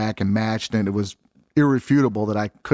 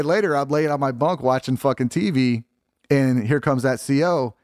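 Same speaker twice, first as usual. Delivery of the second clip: normal talking voice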